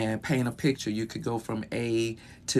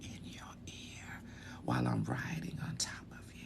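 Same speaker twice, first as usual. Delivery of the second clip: intimate erotic whispering; seductive whisper; 11 semitones lower, 12 dB quieter than usual. Shown as a demonstration. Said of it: "I wanna whisper it".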